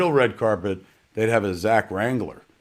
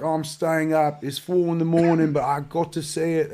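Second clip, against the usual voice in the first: higher-pitched, badly faked British accent; hammed-up British impression of excited fans; terrible British accent; 6 semitones higher than usual. UK fans tweeting about staying up late, bad British accent. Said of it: "the worst British accent you've ever heard".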